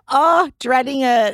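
deadpan a fake complaint; sarcastic.

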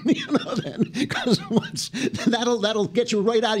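high-pitched talk through chuckles; amused, words broken by laughter; laughing. Real, not acted. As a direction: crack up at your own advice. Laughs at his pro tip.

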